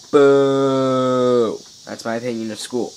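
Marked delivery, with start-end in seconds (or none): 0.1-1.6 mock boo. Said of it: "booing school".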